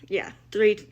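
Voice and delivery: usual speaking voice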